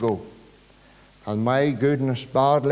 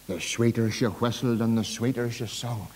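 normal talking voice

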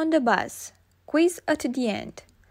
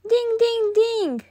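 mock shout; 8 semitones higher than usual. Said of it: "shouts George".

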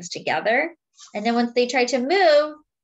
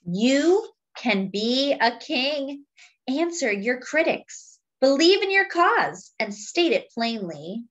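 slow, weighty refrain then lively lines; storybook reading with a slow refrain; reading to children; 3 semitones higher, slower than usual. Acted. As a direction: read the book aloud to the class. Reading picture book to children.

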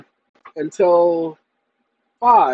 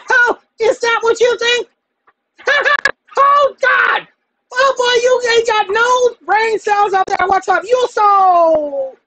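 high mocking voice; 8 dB louder, slower than usual.

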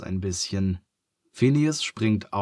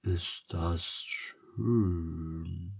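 softer, very slow sleepy murmur; 2 semitones lower, 7 dB quieter, much slower than usual. A narrator's reading. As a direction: murmur it drifting off. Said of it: "murmelt Phineas". (Phineas murmelt schläfrig, leise und sehr langsam)

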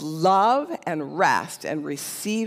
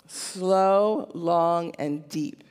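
slow and drawn out; much slower than usual. Real, not acted. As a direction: stretch each word, pausing between. Repeats the slow breath phrase for emphasis.

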